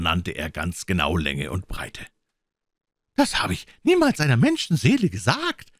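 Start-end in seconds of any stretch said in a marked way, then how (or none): 3.2-5.7 very high, indignant outcry; agitated squire, voice leaping up; exclaiming squire. A narrator's reading. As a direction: protest in a high-pitched cry. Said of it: "rief der Squire".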